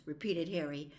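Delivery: ordinary delivery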